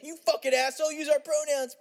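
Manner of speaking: high-pitched, hammed-up indignation; mock angry scolding. Acted angry scolding of the hippie.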